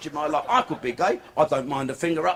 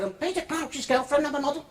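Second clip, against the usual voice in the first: imitating the girlfriend; 8 semitones higher than usual. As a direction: play her a bit airy and pleased. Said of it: "She said".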